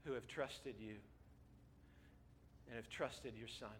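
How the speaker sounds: low, soft, reverent prayer. Real, not acted. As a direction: pray quietly, pausing between phrases. Leads the closing prayer.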